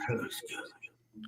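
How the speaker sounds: slow breathy whisper. Real, not acted. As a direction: whisper it slowly, barely there. Quiet reaction while on stream.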